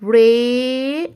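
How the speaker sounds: usual speaking voice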